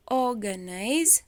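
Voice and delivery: "organize" with a drawn-out, punched OR; stress on first syllable. Shown as a demonstration. Teacher reading question three aloud, "organize" stressed on OR. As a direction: lean hard and slow on OR in "organize". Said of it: "it's the first".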